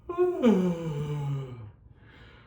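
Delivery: loud open-mouthed yawning sigh falling away. Shown as a demonstration. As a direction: sigh out big like a yawn, mouth tall. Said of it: "Yawning sighs".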